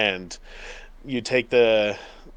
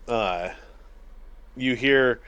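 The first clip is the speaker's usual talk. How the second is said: normal talking voice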